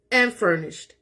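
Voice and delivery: ordinary delivery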